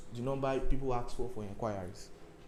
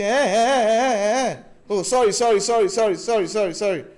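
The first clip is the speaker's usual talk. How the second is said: much higher, louder, loud sorrys piled up; loud flustered apology; 8 semitones higher, 12 dB louder than usual. Retold or imitated. Trader realizing he shouted at a customer, loud flustered apology. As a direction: blurt out the sorrys over and over.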